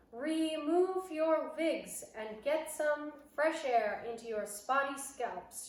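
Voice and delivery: high, loud, very slow start then easing; harsh witch shout rolling the R; shouted witch order. Acted. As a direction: sneer the order at the bald witches. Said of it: "she shouted".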